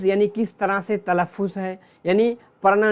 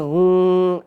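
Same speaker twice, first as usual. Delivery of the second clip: demonstrated angry "hmm", drawn out and louder; angry grunt; 4 dB louder, much slower than usual. Shown as a demonstration. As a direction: growl a long, mock-angry hum. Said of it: "तो गुस्से में". (गुस्से वाली लंबी हूँ)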